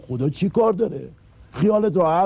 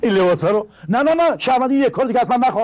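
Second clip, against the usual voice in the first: alarmed refusal; 7 semitones higher, 4 dB louder, faster than usual. Acted. Refuses to wear the costume.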